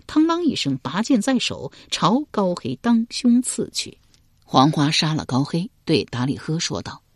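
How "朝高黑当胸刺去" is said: ordinary delivery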